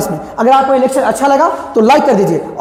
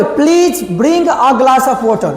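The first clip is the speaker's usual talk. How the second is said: slow request; much slower than usual. Shown as a demonstration. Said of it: "तो क्या बोले".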